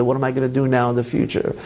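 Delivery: normal talking voice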